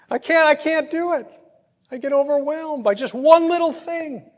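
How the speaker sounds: high and loud, pressing each word; impassioned pulpit confession; preaching at full force. Acted. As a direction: cry it out to the congregation.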